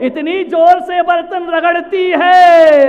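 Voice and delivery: high, loud, drawn-out climax; emphatic shout. Real, not acted. Preacher on maid scrubbing pots hard, shouted slowly. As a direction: stretch it out forcefully.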